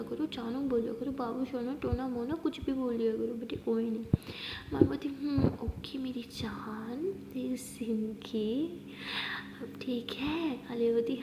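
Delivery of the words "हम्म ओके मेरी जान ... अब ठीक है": slow, tender and drawn out; lovingly. Acted. Maya sweetly calls Aliya her life, slow and tender. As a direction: melt over each pet name, very slowly. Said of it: "इतने प्यार से बोल रही हो".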